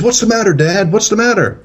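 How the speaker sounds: very high, panicky imitated son; son freaking out. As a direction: mimic an alarmed kid calling to Dad. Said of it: "he's freaking out".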